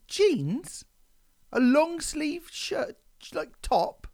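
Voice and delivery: high, slow disbelieving voice; incredulous mock voice. Host mocks a woman in jeans, high and incredulous. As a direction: list her clothes in high-pitched disbelief. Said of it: "'cause you would go".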